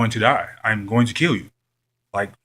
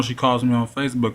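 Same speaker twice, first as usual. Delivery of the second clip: ordinary talk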